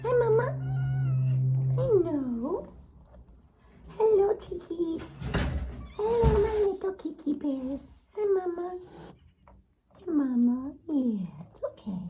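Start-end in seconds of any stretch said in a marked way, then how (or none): 0.0-10.7 cooing pet talk. Real, not acted. Owner saying hello to the kitties, high cooing. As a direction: coo sweetly in a raised voice.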